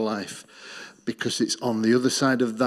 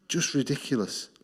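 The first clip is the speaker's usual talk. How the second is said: normal talking voice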